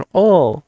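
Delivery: "oh" rounded, like a bubble of air in the mouth; rounded dark L. Shown as a demonstration. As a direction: round "oh" with the tongue pulled back. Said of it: "vamos a hacer un poquito más así". ("oh" redondeado, como burbuja de aire)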